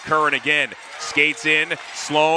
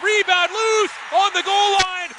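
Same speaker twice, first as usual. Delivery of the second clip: much higher, excited call; excited goal call; slower than usual. Real, not acted. Goal call in hockey play-by-play.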